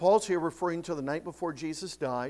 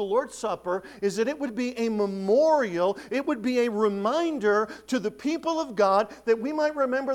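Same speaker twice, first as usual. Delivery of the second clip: louder, higher sermon delivery; preaching; 6 semitones higher, 6 dB louder than usual. Acted. Pastor stating the Supper's purpose, emphatic preaching. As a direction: drive the point home.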